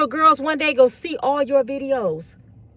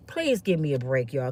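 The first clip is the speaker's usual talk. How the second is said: low, soft plea for a break; humble plea; 10 semitones lower, 7 dB quieter than usual. Acted. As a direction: drop low and quiet, asking for understanding. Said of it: "I'd be like".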